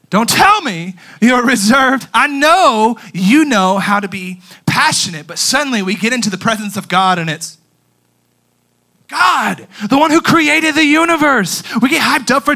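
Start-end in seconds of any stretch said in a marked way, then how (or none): none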